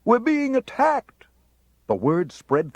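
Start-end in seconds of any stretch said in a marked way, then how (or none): 0.0-1.3 high, loud, slow alarmed shout; panicked cry. A narrator's reading. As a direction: cry it like the city is falling. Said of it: "they cried".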